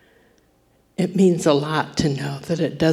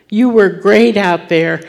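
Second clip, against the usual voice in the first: louder, ringing out; proclaimed praise; 3 semitones higher, 7 dB louder than usual. Acted. Preacher voicing God to his daughter, proclaimed loudly. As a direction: declare it boldly to the whole church.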